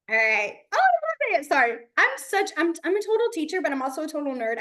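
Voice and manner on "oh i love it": much higher, louder gush of delight; giddy delight. Real, not acted. Teacher gushing over a student's fingerspelling, very high. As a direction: let real joy spill over. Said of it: "I get giddy about every little thing".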